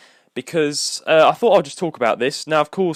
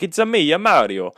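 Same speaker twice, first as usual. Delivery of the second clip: higher, sing-out classic Italian Mario voice; 4 semitones higher than usual. Acted. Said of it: "the Italian, you know, the classic".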